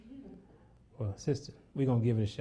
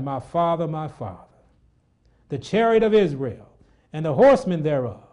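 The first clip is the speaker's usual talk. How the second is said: loud anguished cry of the disciple; anguished cry, preached loud; cried out; 4 semitones higher, 9 dB louder than usual. Acted. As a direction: call out after the departing master. Elisha's cry in scripture.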